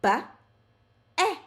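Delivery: "Ba-" then "at", split apart; sounded out. Shown as a demonstration. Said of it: "and sound it out".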